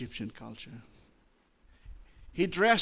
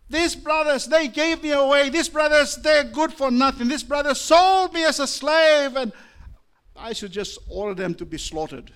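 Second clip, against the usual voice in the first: shouted, much higher and louder, spitting out the grievance; Joseph's bitter anger; 13 semitones higher, 12 dB louder than usual. Acted. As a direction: rage at the brothers who sold him. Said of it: "he was wrestling and said".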